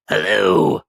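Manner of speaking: loud mock-gruff bark of a miser; feigned growl. Scrooge faking his old gruffness at late clerk Bob, mock growl. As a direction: pretend to be the old grumpy Scrooge. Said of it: "growled Scrooge in his accustomed voice, as near as he could feign it".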